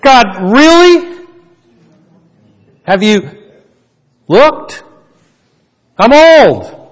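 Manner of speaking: high, loud protest of sheer disbelief; loud, high-pitched incredulity with halting pauses; incredulous Zechariah. Acted. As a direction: question God in exasperated amazement, voice leaping up. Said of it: "hear it in that kind of a voice".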